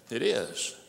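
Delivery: ordinary talk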